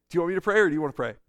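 breezy rushed question; quick offer. Pretend. Pastor models family reply, fast. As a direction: rattle off "Do you want me to pray" fast. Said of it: "You just say".

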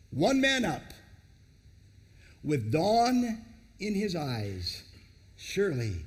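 slow, measured spoken-word delivery; poem recital. Acted. Preacher opening the sermon with a slow poem. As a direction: dwell on each phrase like verse.

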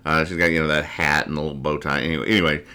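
ordinary talk

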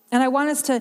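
normal talking voice

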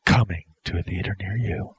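soft, low closing tagline; trailer announcer. Pretend. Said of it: "you're narrator".

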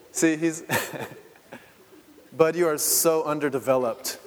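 laughing teasing aside. Real, not acted. Father teasing his toddler on stage, laughing.

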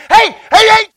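high, shouted greeting; excited calling out. Acted. God greeting someone showing up, excited shout. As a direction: call out loudly, thrilled to see them.

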